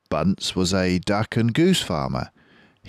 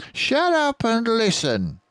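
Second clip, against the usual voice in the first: much higher curt voice; Bean snapping. Acted. Bean silencing Bunce, high curt voice. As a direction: cut him off sharply. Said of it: "said Bean".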